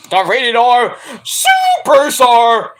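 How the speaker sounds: slow, high hammed-up announcer shout; ring announcer. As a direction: stretch it out like a wrestler's introduction. Mock ring announcer introduction.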